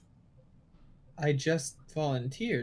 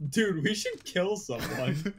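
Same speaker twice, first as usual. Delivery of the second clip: mock sinister; 5 semitones higher than usual. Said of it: "It goes from".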